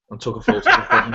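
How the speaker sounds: сквозь смех; laughing. Real, not acted. Laughing while retelling the photo.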